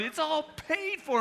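high, loud, drawn-out cashier call; acted excited reveal, slow and ringing; gleeful announcement. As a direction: sing it out proudly to the customer. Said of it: "the cashier started getting into it".

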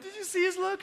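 high-pitched giddy kitchen crew; acted loud, gleeful gossip; amused excitement. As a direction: squeal it to a co-worker, delighted. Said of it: "they're all laughing".

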